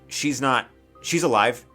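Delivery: normal talking voice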